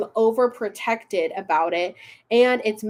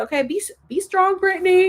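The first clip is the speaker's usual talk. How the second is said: mock encouragement; 9 semitones higher, 4 dB louder, faster than usual. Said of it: "I was like".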